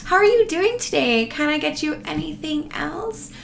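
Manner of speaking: high, bright-toned waiter greeting; chirpy server. Retold or imitated. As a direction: play the overly eager table server. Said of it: "No one comes to you and is like".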